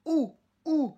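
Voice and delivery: U said high as ou, never the French U; U, Spanish ou sound. Shown as a demonstration. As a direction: round the lips to ou on U. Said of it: "on ne dit pas le U".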